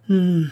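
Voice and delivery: high, loud shaky hmm of a sigh; unsteady sigh. A narrator's reading. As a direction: sigh it out, unsure of himself. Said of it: "soupira-t-il d'une voix mal assurée". (il soupire d'une voix mal assurée en gardant la bague)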